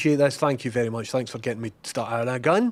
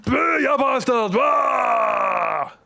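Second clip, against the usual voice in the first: higher, louder jump-scare shout; startling boo; 11 semitones higher, 8 dB louder, much slower than usual. Pretend. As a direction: leap out and yell boo. Jumps out to scare player.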